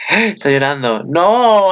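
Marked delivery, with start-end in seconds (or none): 1.0-1.7 loud, high "no"; dismayed cry. Real, not acted. Vlogger seeing a character cry, dismayed. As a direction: protest at the sad turn.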